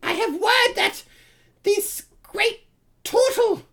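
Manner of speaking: squeaky, very high kobold voice; Kakarol. Acted. Kobold leader reporting word of the intruders, high-pitched accusation. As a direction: lay out the charge in a high creature voice.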